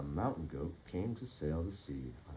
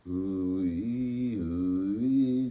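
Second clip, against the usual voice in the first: whistling snore sounds; snoring foghorn and whistle; 6 dB louder, much slower than usual. Acted. Sleeping goat and elephant snoring, a whistle sound. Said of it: "snored like a foghorn and a whistle".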